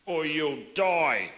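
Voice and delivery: slow, booming ad voiceover; slow ominous announcer warning; big New Zealand voice. Retold or imitated. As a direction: lay it down like a grim road-safety ad. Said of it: "a big New Zealand voice going".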